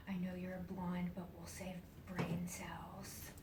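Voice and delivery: slow, hushed teasing; whispered tease. Retold or imitated. Nurse teasing the blonde patient, in a slow whisper. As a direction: whisper the joke slowly, teasing and gentle.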